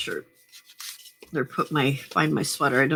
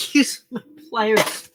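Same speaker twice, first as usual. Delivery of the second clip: laughing through the words; 5 semitones higher, slower than usual. Real, not acted. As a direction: giggle it out. Laughs at own mistake.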